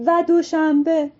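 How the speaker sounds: fed-up shout. Acted. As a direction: shout it down, exasperated. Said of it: "از اون بالا داد زد".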